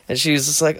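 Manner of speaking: ordinary delivery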